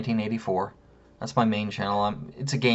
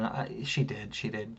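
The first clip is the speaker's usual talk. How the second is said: quiet admission; 9 dB quieter, slower than usual. Real, not acted. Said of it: "thinking about it again".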